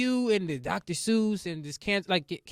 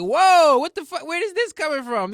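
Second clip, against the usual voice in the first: shocked outburst; 10 semitones higher, 10 dB louder than usual. Retold or imitated. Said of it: "And I was like".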